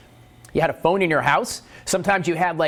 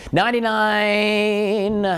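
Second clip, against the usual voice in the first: drawn out; 7 semitones higher, much slower than usual. Pretend. Drawn-out answer pick.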